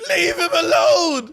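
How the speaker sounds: high, slow laughing shout; mock shout. Mocking his friend's yell at the bird, laughing loudly. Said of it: "echoing the yell".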